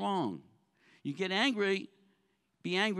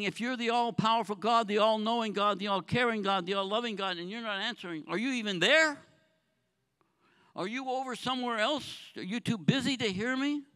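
raised, louder pleading voice, pitched higher; crying out to God; 4 semitones higher, 5 dB louder than usual. Acted. David crying out to a silent God, loud pleading. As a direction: demand an answer from a silent God. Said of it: "And he's crying out".

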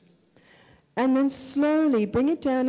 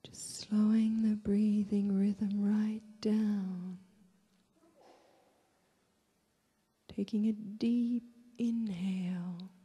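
guided meditation; 4 dB quieter than usual. Real, not acted. Leads a breathing meditation.

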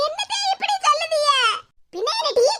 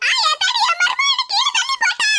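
far higher, laughing cry of outrage; comic wail; 9 semitones higher than usual. Acted. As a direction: wail out as you hit the road. Mother-in-law falls, wails at daughter-in-law.

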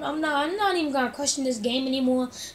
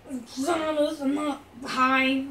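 mock slurred; much slower than usual. Joking claim while slurring.

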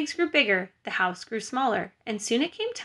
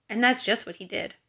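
ordinary delivery

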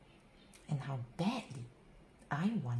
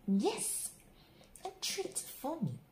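ordinary delivery

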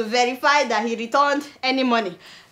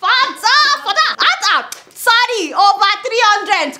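surprised exclamation; 7 semitones higher, 4 dB louder, slower than usual. Real, not acted. Exclaims at 300 aides.

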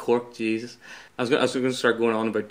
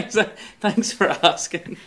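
laughing thanks; 4 semitones higher, slower than usual. Real, not acted. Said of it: "thanks for asking. Not gonna say that".

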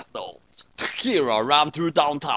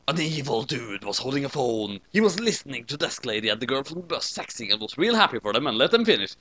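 ordinary delivery

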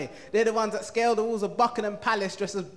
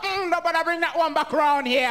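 higher disapproving African mum voice; mum's voice; 7 semitones higher, 4 dB louder than usual. Retold or imitated. Mum dismissing a girl her son brings home, higher and judgy. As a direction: judge the girl like a strict mum. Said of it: "she's like".

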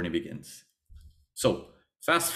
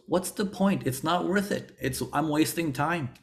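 frustrated inner voice; 6 semitones higher than usual. Retold or imitated. Trader voicing his past self, mildly fed up and whiny. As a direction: sound like you're giving up, lightly voiced.

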